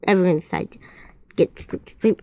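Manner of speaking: plain reading voice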